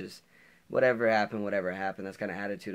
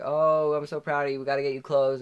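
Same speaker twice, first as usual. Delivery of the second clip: imitating an excited mom, higher and louder; 6 semitones higher, 7 dB louder than usual. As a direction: gush with pride as a thrilled mom. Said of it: "starts getting all excited".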